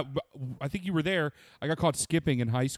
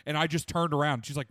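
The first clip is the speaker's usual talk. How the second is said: ordinary delivery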